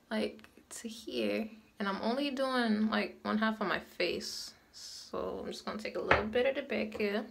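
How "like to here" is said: slow whisper under the breath; whispered aside. Real, not acted. Showing viewers where to apply, whispered. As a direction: murmur it to yourself, slow.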